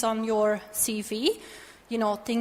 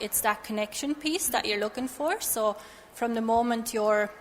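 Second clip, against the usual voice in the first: normal talking voice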